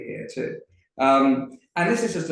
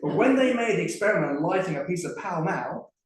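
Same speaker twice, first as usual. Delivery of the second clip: higher, lively Cockney lamplighter telling a tale; Tom the lamplighter; 5 semitones higher, 4 dB louder than usual. Acted. Performer reads Tom the Lamplighter to the audience, lively Cockney storytelling. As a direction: spin the yarn like a chatty Cockney geezer.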